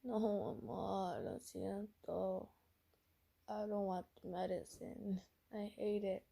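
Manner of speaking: soft, slowed, pleading sick voice; Robin. Acted. Sick character in Spanish mode refusing medicine, soft mild whine. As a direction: draw out the words in a feeble protest. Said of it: "So Robin's like".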